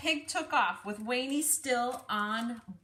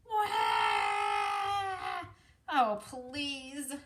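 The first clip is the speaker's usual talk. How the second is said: crying wail; 6 semitones higher, much slower than usual. Acted. Little brother wailing at the dull chores, high fake sob. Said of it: "Wayne started to cry".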